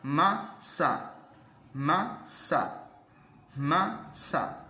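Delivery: masa with the m hummed through the nose; nasal m demo; demo word masa. Shown as a demonstration. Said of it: "diga masa".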